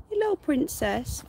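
high, slow pet greeting; cooing to rabbit. Real, not acted. Owner greeting her rabbit with a high crooned hello.

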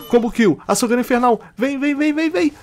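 high, fast hype; eager high chant; excited hype. Real, not acted. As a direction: pump yourself up for the combo. Going for a combo kill.

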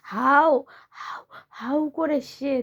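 slow, drawn-out spooky bogeyman voice; spooky bogeyman call. Pretend. Child acts out the bogeyman for parent, slow spooky call. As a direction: draw out the hau like a spooky monster.

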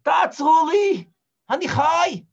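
high, loud pleading shout; pleading shout to the departing sailors; calling out. Acted. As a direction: call after them to stop. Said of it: "ואני אומר להם".

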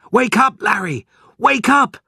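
high, loud wake-up call; urgent rousing shout. A narrator's reading. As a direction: shout to rouse a sleeper. Mother shaking sleeper during fire.

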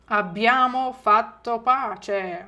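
slow, clear, syllable by syllable; slow model phrase. Shown as a demonstration. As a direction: say it slowly for the class. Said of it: "Ще раз".

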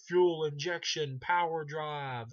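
loud, high, drawn-out villain one-liner; Freddy. Retold or imitated. Freddy throwing out corny one-liners, slow mock holler. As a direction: belt it out slowly, hamming up each word. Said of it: "he's like, Freddy's like".

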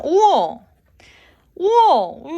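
excited wow. Real, not acted. Pizza box opened.

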